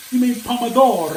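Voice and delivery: imitating an Italian man correcting him in an Italian accent; mock Italian. Cook retells an Italian man in Pisa correcting him, mock Italian accent. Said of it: "a little Italian dude who says".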